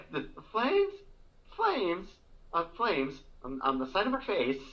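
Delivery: high, halting flames, breaking into laughter; Madeline Kahn. Retold or imitated. Friend quoting a film scene they love, halting impression. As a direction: stammer out the flames, drawn out and high. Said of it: "Madeline Kahn, though, with her subtlety".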